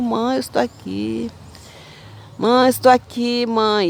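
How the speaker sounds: retold, half-performed desperate plea; mildly anguished calling. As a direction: call out to a mother who won't look, lightly agonized. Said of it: "E eu falava já agoniada".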